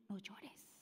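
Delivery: barely breathed whisper, very soft; hushed tender whisper; whispered comfort. Acted. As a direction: breathe it like a secret to a mourner. Jesus speaks to grieving mother.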